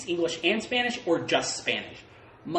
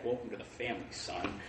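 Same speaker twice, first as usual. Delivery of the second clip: low, hushed villain line; hushed movie quote; 8 semitones lower, 14 dB quieter than usual. Retold or imitated. Repeating the film line under his breath, hushed. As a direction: murmur it like a menacing film line.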